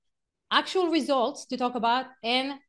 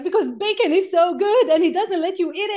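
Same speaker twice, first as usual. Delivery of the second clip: put-on higher, louder child's voice, rushed and earnest; hammed-up little boy protesting; son's voice; 6 semitones higher, 7 dB louder than usual. Quoting her five-year-old son about bacon, hammed-up childlike protest. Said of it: "And he's like".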